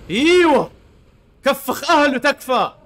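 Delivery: high loud cheering yell; loud high triumphant cheer; excited shout. Real, not acted. Cheering a hit in game.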